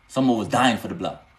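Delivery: normal talking voice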